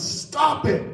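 high-pitched mock yell; retold yell. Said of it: "He yells".

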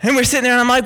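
normal talking voice

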